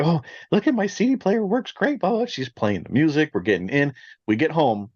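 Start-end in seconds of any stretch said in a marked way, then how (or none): 0.0-2.3 high, quick mock excitement; imitating excited teen. Parent imitating daughter showing off her CD player, excited. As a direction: gush like a thrilled kid, voice pitched up. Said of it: "She's all excited".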